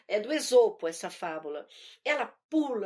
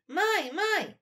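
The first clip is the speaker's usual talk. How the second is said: put-on higher childish chant in steady metre; little girl singsong; 5 semitones higher, 3 dB louder than usual. Little girl tells mother about the locked kitchen, high singsong. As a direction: recite it like a small child's rhyme. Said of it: "é, fiz assim".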